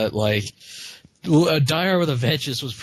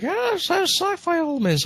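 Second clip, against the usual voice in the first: high mocking pedant voice; mocking nerd voice. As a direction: mimic a fussy know-it-all. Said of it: "everyone would be like".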